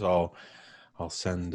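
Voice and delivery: ordinary delivery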